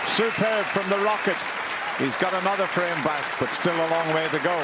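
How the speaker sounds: high, loud, thrilled call; excited commentary. Real, not acted. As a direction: shout it over the crowd roar. Century celebrated over applause.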